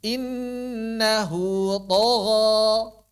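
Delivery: Innahu tagha very slowly, with a thick drawn tagha; slow heavy recitation. Shown as a demonstration. Teacher recites the verse again slowly for students. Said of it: "Jangan dibalik, tagha".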